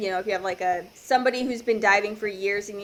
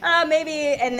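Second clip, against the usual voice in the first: high, louder mock second thoughts; humbled doubt; 7 semitones higher, 4 dB louder, slower than usual. Diver hit by reality again, mock humbled. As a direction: imitate a know-it-all suddenly unsure. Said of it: "it, like, hits again".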